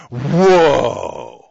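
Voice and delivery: sarcastic exaggerated whoa; mock surprise. Real, not acted. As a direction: fake a startled gasp to mock the news. Mock reaction to news read.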